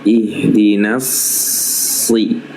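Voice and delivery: "Ihdinas si" recited slowly, S held heavy all through; slow recitation demo. Shown as a demonstration. Tajwid teacher slowly recites the correct heavy S. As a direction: draw out "Ihdinas si" slowly with a full S. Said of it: "Jangan nasi".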